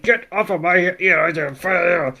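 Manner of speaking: slow, garbled mock struggle; mock struggling Dragonite. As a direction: drag it out as if wrestling someone off. Dragonite struggling with Pikachu hanging on.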